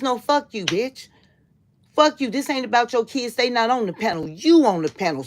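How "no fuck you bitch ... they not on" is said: angry retort. Real, not acted. Cursing out the mocked woman.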